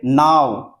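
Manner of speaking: नाव with a long, held AA; drawn-out vowel. Shown as a demonstration. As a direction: stretch नाव out slowly. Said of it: "time लगेगा".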